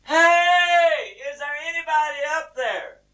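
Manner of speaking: high, drawn-out yell. Acted. Stranded man calling up the cliff, hollering harder. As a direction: holler with everything you've got. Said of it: "he hollered a little louder".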